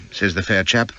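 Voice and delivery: plain reading voice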